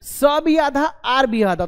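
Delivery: normal talking voice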